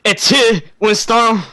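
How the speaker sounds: high, loud put-on French accent. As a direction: exclaim it in a mock French voice. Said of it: "that's my French voice".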